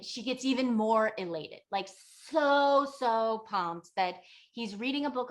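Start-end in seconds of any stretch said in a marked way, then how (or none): 2.3-2.9 "so" drawn out high and loud; emphatic SO. A narrator's reading. Storyteller on how pumped she is, emphatic "so". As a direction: hit "so" hard and stretch it. Stressing her elation.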